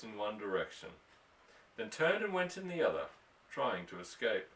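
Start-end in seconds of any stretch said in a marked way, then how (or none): none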